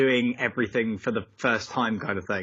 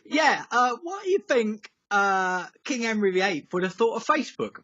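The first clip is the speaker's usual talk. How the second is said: audience guy; 8 semitones higher than usual. Retold or imitated. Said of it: "one guy from Wycombe just like".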